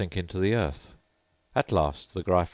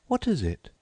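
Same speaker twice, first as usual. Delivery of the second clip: put-on much higher polite girl voice; Alice's voice; 12 semitones higher than usual. A narrator's reading. Alice thanking the Mock Turtle, high and polite. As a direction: pitch up into a polite young girl.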